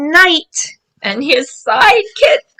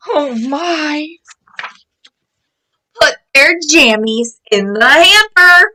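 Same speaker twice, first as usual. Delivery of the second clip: ordinary delivery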